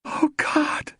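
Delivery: a high, breathless gasp of horror; whispered horror behind covered face; horrified gasp. A narrator's reading. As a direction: gasp it into her hands. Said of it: "she said and covered her face".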